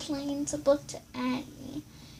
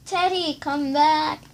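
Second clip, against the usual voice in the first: calling out; 3 semitones higher, 8 dB louder than usual. A narrator's reading. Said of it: "cried Annie".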